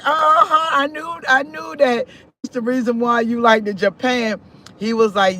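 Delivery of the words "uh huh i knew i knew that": high, knowing uh-huh. Retold or imitated. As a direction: tease him, I knew it. Knowing reply to old man.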